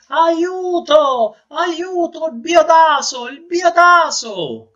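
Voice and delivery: high, loud stuffed-nose cry for help; panicked cry. Acted. Noseless man at the mirror calls for help, crying out high. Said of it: "gridò".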